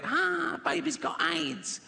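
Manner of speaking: high, drawn-out crowing taunt; slow, high-pitched comic gloat; gleeful mocking punchline. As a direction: stretch it out high, like jeering at the news. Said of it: "it gets to that bit".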